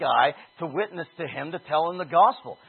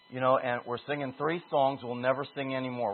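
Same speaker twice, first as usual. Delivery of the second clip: ordinary talk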